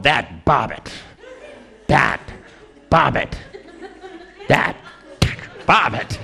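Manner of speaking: slow, spaced "That Bobbitt" with pauses between words; mocking the name, drawn out and pausing; spiteful repetition. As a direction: spit out "Bobbitt" over and over with long gaps. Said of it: "She kept going".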